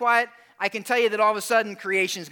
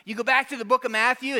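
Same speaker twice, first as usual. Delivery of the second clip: normal talking voice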